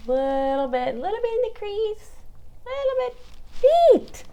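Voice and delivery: teasing singsong that ends high; sing-song rising. Real, not acted. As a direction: let the voice climb playfully up. Sing-song while applying bronzer.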